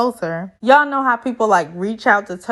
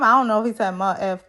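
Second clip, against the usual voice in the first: normal talking voice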